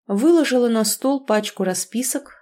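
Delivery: ordinary delivery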